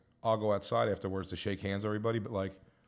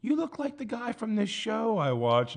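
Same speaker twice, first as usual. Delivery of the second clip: high, slow mock female voice; imitated lady fan; 11 semitones higher, slower than usual. Older lady recognizing him in Starbucks, in a high imitated voice. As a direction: draw it out up high, admiring.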